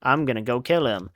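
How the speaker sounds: plain reading voice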